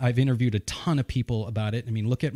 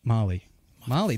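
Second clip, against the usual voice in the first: Molly said the New England way, short open "Mah"; proud New England Molly; New England accent; 3 semitones lower than usual. Shown as a demonstration. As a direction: give Molly a New England vowel, like "Mahlly". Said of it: "it's a New England accent".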